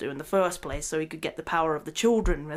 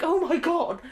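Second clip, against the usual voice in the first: shocked gasp; 10 semitones higher than usual. Retold or imitated. Rudolph finds Frosty dead.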